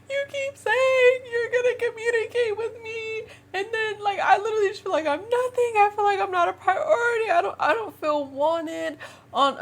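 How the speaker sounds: imitated high tearful whine; angry crying. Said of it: "I'm, like, angry crying".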